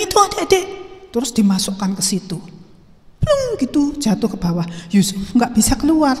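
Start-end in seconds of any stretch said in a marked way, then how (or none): 3.2-3.5 high, falling "plung" sound effect; imitated plunge. Storyteller imitates boy falling into well, imitated plunge. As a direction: voice the drop into a well.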